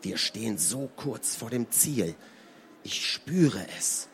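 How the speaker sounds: leise, gepresst und langsam; hervorgepresst. Acted. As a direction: die Worte zwischen den Zähnen hervorpressen. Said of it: "presste er hervor". (leise hervorgepresst)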